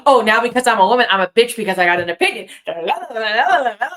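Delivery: retold yelling rant. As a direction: rattle off the angry comeback. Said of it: "Why are you yelling?".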